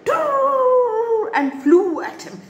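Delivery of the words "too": high, drawn-out bird hoot; angry bird noise. A narrator's reading. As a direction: screech one long angry bird call. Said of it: "made an angry noise".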